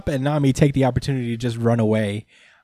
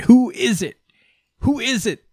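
hyped reveal; 7 semitones higher, slower than usual. Real, not acted. Hyping a dramatic entrance.

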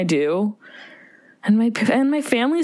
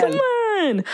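mock whine; 13 semitones higher than usual. Real, not acted. Echoing friend's whine.

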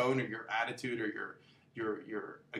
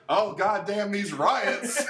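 slow, louder grumbling boomer; mock boomer gripe; 6 semitones higher, 5 dB louder, much slower than usual. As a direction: drawl it out like a cranky old customer.

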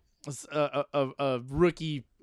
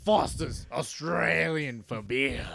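slow mock Australian slogan read; Australian accent; slower than usual. Host quoting a beer slogan for laughs, mock Australian. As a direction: draw it out in a put-on Australian accent. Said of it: "you know Australian".

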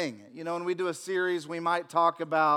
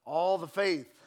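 usual speaking voice

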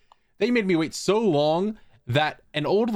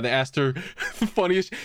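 ordinary talk

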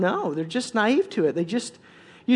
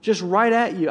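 ordinary delivery

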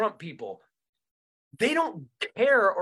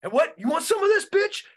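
much higher, louder mock challenge; tough-guy taunt; 10 semitones higher, 6 dB louder than usual. Pretend populist candidate taunting an opponent, high, loud. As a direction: square up and dare them. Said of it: "a tough person who goes".

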